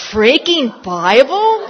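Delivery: loud, drawn-out incredulous echo; mock shock. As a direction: act out scandalized disbelief, slow and loud. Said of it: "I was shocked".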